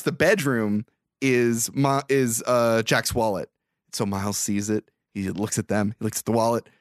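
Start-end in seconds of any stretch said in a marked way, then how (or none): none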